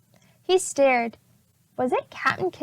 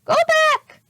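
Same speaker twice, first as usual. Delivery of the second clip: very high, loud parrot voice; squawking parrot; 5 dB louder than usual. A narrator's reading. Polly the parrot warning the children, high squawk. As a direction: screech it like a parrot. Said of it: "squawked Polly".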